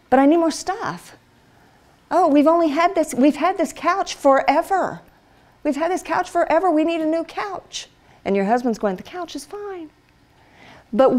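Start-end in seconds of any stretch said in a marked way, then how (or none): 0.1-7.9 higher, pleading wife wanting more stuff; a hint of a wheedling wife; wife nagging husband for a new couch, mildly whiny; mock whiny wife. 9.0-9.9 much softer, unbothered husband; a hint of a bored husband brushing it off; husband dismissing wife's couch request, softly; flat husband retort.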